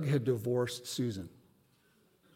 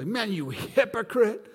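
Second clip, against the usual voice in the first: mock prophet rebuke; 6 semitones higher, 4 dB louder than usual. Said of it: "he didn't come in and go".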